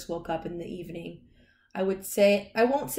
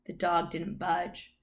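plain reading voice

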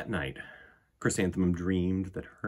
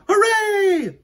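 put-on high, loud child's cheer; excited cheer; 12 dB louder than usual. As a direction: burst out with joy. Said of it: "said Chrysanthemum".

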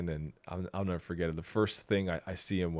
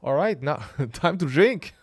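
excited party guest; 8 semitones higher, 6 dB louder than usual. Retold or imitated. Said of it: "Filipinos are like".

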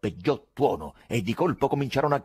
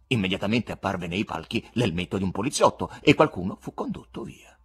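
plain reading voice

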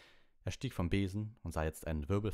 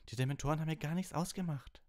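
high, quick, excited outburst; Harry, excited; 11 semitones higher, faster than usual. A narrator's reading. Harry telling Professor Lupin about the Dementors, excited. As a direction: rush it out, pitched way up. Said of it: "sagte Harry aufgeregt".

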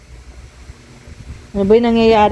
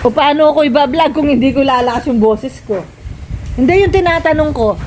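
usual speaking voice

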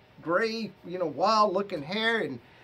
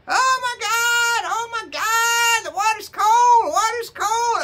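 shrill high imitated scream, much louder and slow at first; imitating mom screaming; 9 dB louder, slower than usual. Son mimics mom screaming in the cold shower, shrill crying yell. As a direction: squeal it like a frail old mom hit by icy water. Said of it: "she's screaming".

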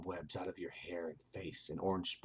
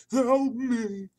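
high, loud wailed plea; hammed-up drawn moan; moaning ghostly voice; 10 dB louder than usual. A narrator's reading. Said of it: "You hear the voice moan".

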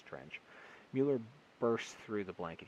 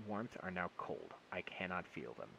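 ordinary delivery, nothing marked

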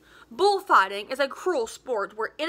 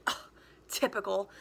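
disgusted; 4 semitones lower, 7 dB quieter than usual. Acted. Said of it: "Hugh was disgusted".

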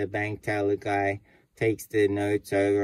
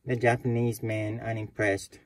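ordinary talk

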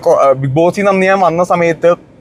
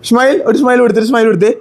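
high sing-song, climbing ever higher; playful coaxing; 8 semitones higher, much slower than usual. Real, not acted. Uncle asking his little nephew for a smile, playful coaxing baby talk.

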